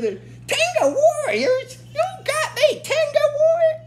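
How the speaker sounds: villain queen teasing her brother in a shrill put-on voice; sarcastic Rita. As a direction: drag out Tenga warriors with a sneer. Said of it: "she's a bit sarcastic when she says this".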